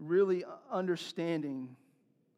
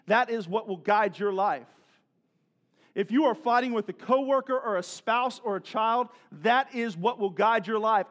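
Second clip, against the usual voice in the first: raised, louder, hammered refrain; building pulpit cadence, weighty on the refrain; full-force preaching; 5 semitones higher, 7 dB louder than usual. Real, not acted. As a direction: hammer each warning home to the congregation.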